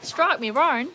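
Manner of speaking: hammed-up Aussie exclamation; terrible Australian accent. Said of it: "my terrible accent".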